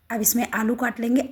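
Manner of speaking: ordinary talk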